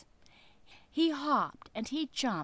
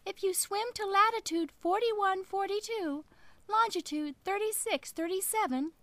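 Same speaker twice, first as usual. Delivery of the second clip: high, louder squeaky fish voice; little fish voice; 7 semitones higher, 6 dB louder than usual. Acted. Little fish flatters the whale, high and piping. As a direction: pipe up like a tiny clever fish. Fish gives long directions.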